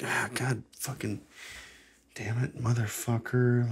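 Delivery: soft, frustrated swearing; muttered cursing. Acted. Host acts out being pissed for no reason, muttered cursing. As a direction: grumble the curse to yourself, held back. Acts out groundless morning anger.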